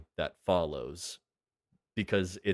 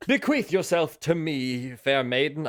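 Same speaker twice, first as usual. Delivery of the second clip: put-on high, loud hammy old-time suitor; overdone silent-film hero; 7 semitones higher, 5 dB louder than usual. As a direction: lay it on thick like an intertitle. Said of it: "I'm overdoing it".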